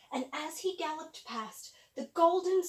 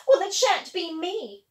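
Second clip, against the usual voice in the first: spoiled stepsister; 4 semitones higher, 11 dB louder than usual. Acted. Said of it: "said the sister who sewed".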